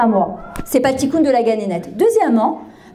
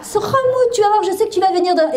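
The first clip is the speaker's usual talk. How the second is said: higher, stretched insincere cooing; hammed-up doting praise for one's child; syrupy raised voice that is not meant; mock gushing; 7 semitones higher than usual. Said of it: "et dans ma tête, je me dis".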